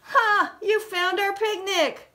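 put-on high, loud happy boy; joyful high-pitched boy's cry; Buzz crying out. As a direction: cheer like a kid finding his pet. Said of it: "cried Buzz".

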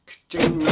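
loud, high ka-ching; cash register ka-ching. Real, not acted. Mocking the bar prices, loud high ka-ching. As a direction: ring it up like a till.

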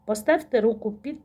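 ordinary talk

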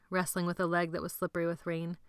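plain reading voice

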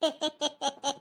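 gloating chuckle; mocking laugh. Friend laughs at Sunila's punctured cycle, mocking laugh. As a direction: laugh at his bad luck.

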